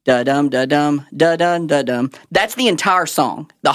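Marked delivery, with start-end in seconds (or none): 0.0-2.1 da-DUM beat, each "dum" leaned on; "dum" stressed after a light "da"; iambic rhythm demo. Shown as a demonstration. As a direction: chant "da-dum" in even weak-strong pairs. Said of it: "iambic tetrameter is sets of four".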